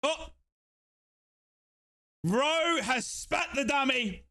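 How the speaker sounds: normal talking voice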